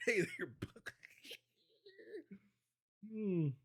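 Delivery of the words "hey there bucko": breezy mock nickname greeting. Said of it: "give you all type of nickname".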